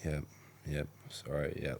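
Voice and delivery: soft, hurried agreeing to end it; dismissive yeps. Retold or imitated. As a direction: brush it off quietly. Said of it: "so I'm like".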